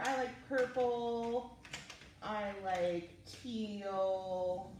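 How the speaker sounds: stretched out slow and lilting; humming the colors while drawing; slow sing-song. Real, not acted. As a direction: croon each color slowly. Coloring, talking to self.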